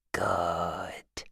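soft whispered approval; low whisper. A narrator's reading. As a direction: whisper it coldly. Phil whispering.

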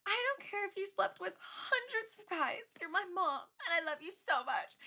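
high, tearful mock plea; Sophie's plea. Imitating Sophie forgiving her mom, high tearful plea. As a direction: plead with your mom, voice up high. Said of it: "she's like".

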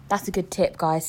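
ordinary delivery

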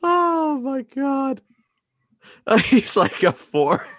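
high-pitched laughing speech; real helpless laughter. Real, not acted. Cracking up at co-host's joke, laughing hard. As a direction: struggle to talk through laughter.

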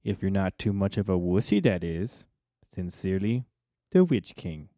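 low, dark menacing sign-off; a low evil king reading his letter; villain voice. Acted.

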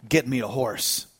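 low, soft and resolute; hushed, low-pitched command; grave quiet order. Retold or imitated. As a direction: give the order gravely under your voice. John's resolute order after grief.

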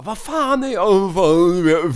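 loud, high mock bewilderment; confused drunken outcry. Storyteller playing his drunk friend lost before a flight, loud bewildered cry.

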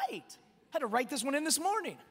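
ordinary delivery

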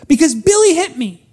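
put-on high, loud tattling child; tattling little girl. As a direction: whine it like a hurt little girl telling on her brother. Annie voiced complaining to Mom.

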